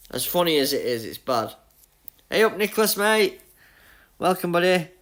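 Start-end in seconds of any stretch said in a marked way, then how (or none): none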